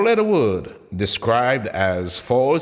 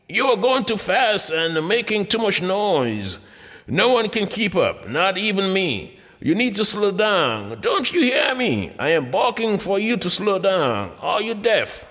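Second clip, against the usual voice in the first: a dog's scolding voice, pitched much higher; barking dog talking; 9 semitones higher than usual. Pretend. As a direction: play the complaining dog, high and pushy. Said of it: "Could these dogs be saying in their language".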